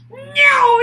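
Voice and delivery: goblin voice. Acted. Goblin taunts Lily with a deal, high slow shout. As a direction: bellow it like a gleeful goblin. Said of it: "smiled the goblin".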